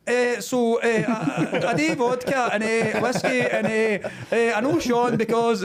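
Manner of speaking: mock bumbling speech. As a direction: stumble through it like an unprepared speaker. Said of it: "there's me standing up going".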